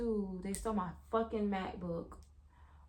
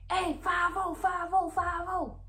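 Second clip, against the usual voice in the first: lookout calling out; 8 semitones higher, slower than usual. Retold or imitated. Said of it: "his homeboys was like".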